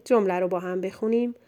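ordinary delivery

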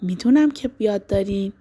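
normal talking voice

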